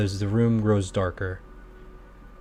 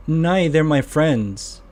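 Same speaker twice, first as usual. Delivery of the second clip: put-on child voice; 7 semitones higher, 5 dB louder, slower than usual. Blind child answers the GM.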